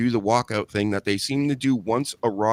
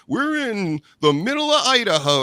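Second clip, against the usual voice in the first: high, louder, stretched-out mock call; imitated announcer; 11 semitones higher, 4 dB louder than usual. Host mimics show in dead town, hammed-up high call. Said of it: "They'll be like".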